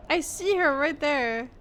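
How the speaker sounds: usual speaking voice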